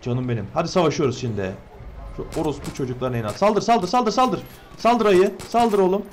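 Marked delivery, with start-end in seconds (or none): 3.4-6.1 high, loud rapid chant of attack; higher, louder battle cheering; excited urging. Real, not acted. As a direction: egg on a fighter.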